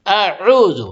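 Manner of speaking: اعوذ with hamza and ain both distinct; clear ain and hamza. Shown as a demonstration. Teacher demonstrating hamza and ain in اعوذ. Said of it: "دونوں کو ایک دم واضح کریں گے".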